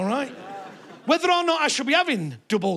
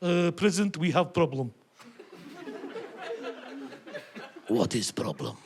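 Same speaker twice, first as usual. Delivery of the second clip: mock Russian accent; 5 semitones lower, 6 dB quieter than usual. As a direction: ham up a Kremlin aide's Russian accent. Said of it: "met Mister Putin and said".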